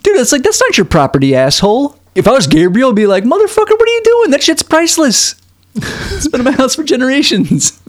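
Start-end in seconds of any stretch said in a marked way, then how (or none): 3.2-7.8 very high, squealing put-on fury; mock outraged owner. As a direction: shriek it like a scandalised collector. Imagined furious doll owner.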